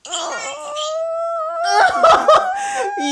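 high, loud laugh close to tears; emotional laugh. Real, not acted. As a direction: burst out laughing through tears.

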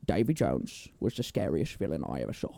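low, soft British impression; Michael Caine. Co-host mashing up butler and pirate film, low hushed impression. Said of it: "Michael Caine's a fun one to do".

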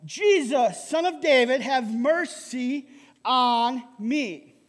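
loud, drawn-out cry for mercy; shout for mercy. Acted. Blind beggar calls to Jesus for mercy, shouting. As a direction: call out loudly, stretching the last words. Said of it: "he began to shout".

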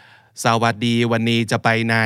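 slow Thai in a Western accent, every tone level; foreigner's toneless Thai. Shown as a demonstration. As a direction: imitate a foreigner speaking Thai all on mid tone. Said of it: "ทุกอย่างเป็นวรรณยุกต์แบบเสียงกลางหมดเลยอะ".

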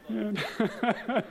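moved laugh. Real, not acted. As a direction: laugh through emotion. Emotional laugh.

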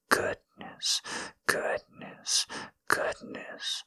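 breathy, hushed whisper right at the ear; intimate whisper. Acted. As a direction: whisper it so no one hears. Boss whispering during secret affair.

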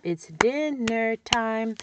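dinner time drawn out slowly; slow syllable modeling. Shown as a demonstration. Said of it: "And I say".

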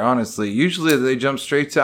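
ordinary talk, nothing marked

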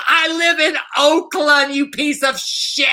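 high shrieking imitation of a yell; mock yell. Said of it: "she yells".

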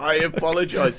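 high, loud, haughty "I apologise"; puffed-up schoolboy owning it grandly; pompous apology. Retold or imitated. Podcast host mocking Jack's pompous apology, high and loud. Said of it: "Use the words".

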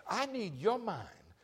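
voiced prayer asking for Christ's mind. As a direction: pray it up toward God. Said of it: "when we are saying".

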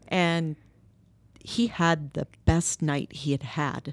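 ordinary delivery

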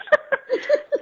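loud, high giggle. Real, not acted. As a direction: giggle at yourself. Laughs at own grudge.

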